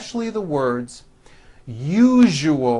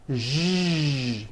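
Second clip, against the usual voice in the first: ordinary talk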